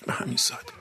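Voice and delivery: به همین سادگی breathed as a soft, voiceless whisper; whispered echo. Real, not acted. Preacher echoing his point to the gathering, hushed whisper.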